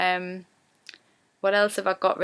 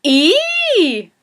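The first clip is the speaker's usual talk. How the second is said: high, drawn-out Geordie "Ee"; a Geordie girl's bright opening "Ee"; 9 semitones higher, 6 dB louder than usual. Shown as a demonstration. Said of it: "we will start the sentence with".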